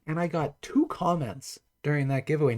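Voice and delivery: ordinary talk, nothing marked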